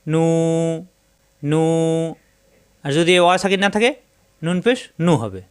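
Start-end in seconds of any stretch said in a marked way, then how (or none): 0.1-1.6 নু with the u held one alif long; elongated syllable. Shown as a demonstration. Teacher shows students নু stretched one alif. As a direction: draw out নু as a long noo. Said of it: "ডানের হরফকে একালিপ টানতে হবে".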